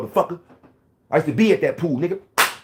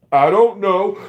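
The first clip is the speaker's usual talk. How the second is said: put-on higher, louder child's answer; sulky kid; 6 semitones higher, 6 dB louder, much slower than usual. Young boy answering his angry mom, high and loud. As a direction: mumble it like a scared boy.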